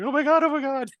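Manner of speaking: very high, loud fangirl squeal; gushing fangirl. Retold or imitated. Podcaster thrilled at landing a favourite author as guest, fangirl squeal. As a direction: squeal it like a starstruck fan. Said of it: "doing the fangirl thing, going".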